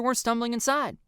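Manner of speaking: plain reading voice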